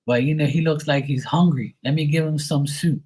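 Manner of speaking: high imitated old-aunt voice; great-aunt quote. Great-aunt wants to feed the boy, high mock voice. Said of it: "And then she's like".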